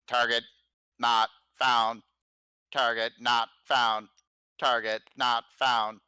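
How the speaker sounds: slow repeated machine announcement; robot voice. Acted. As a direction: drone it like a malfunctioning machine. Machine voice announcing target lost.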